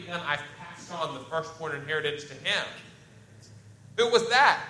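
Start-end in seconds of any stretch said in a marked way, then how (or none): 4.0-4.6 sharp, high, loud shocked question; stunned father demanding who it was; surprised exclaim. Retold or imitated. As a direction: blurt it out in alarm. Voicing Isaac's shocked question.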